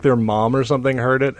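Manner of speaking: ordinary delivery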